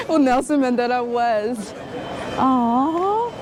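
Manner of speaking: usual speaking voice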